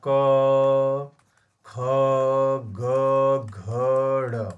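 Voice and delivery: क, ख, ग, घ, ड़ each held long on a monotone; drawn-out letter sounds. Shown as a demonstration. Teacher reciting the क-वर्ग for students, each sound long and sustained. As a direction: sound out क ख ग घ ड़ singly, stretched, with pauses.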